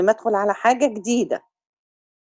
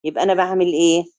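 normal talking voice

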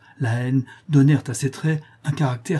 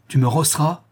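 higher, indignant question; furious challenge; 5 semitones higher, 4 dB louder than usual. A narrator's reading. Furious bandit with a knife defies his brother, angry challenge. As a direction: dare him angrily. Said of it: "s'écria le bandit furieux".

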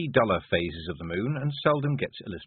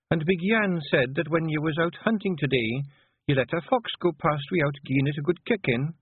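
higher, gently chiding Scots wife; Scottish wife; 8 semitones higher than usual. Acted. Wife creeping up on her brooding husband, gently chiding. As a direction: speak as a concerned Scottish wife. Voices Jeanie.

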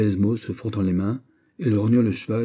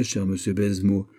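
ordinary delivery

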